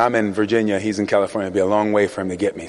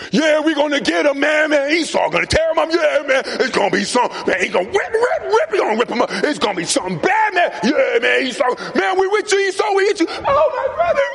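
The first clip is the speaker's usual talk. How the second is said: rowdy gang member; faster than usual. Acted. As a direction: egg on Esau like a rowdy mob. Preacher voices Esau's four hundred men.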